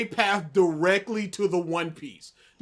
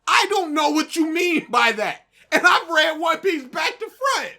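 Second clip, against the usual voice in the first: louder and higher, laughing in disbelief; frustrated outburst; 5 semitones higher, 6 dB louder than usual. Real, not acted. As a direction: vent the frustration loudly. Frustrated rant.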